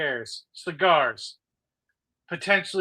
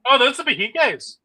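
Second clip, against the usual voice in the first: excited; 7 semitones higher, 9 dB louder than usual. Real, not acted. Excited at the cigars.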